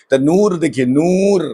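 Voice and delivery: teacher stretching نور with a long rising oo; drawn-out noor. Shown as a demonstration. Urdu teacher demonstrating a long drawn نور for the class. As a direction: say نور with a long pushed-out oo, lips rounded. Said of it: "کھینچ کے پڑھتے ہیں".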